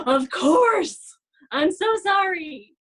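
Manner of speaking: imitating her shocked apology; startled apology.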